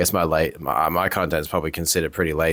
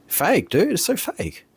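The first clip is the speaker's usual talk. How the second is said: exasperated; 13 semitones higher, slower than usual. Real, not acted. Exasperated rant about fake videos.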